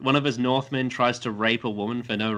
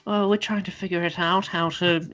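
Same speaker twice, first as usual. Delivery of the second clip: higher mock-female voice; put-on higher voice, hesitant answer; Daenerys; 6 semitones higher than usual. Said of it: "And then she's like".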